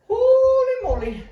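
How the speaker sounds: high, loud, slow holy moly; winded amazement; excited exclaim. Real, not acted. Winded after the set.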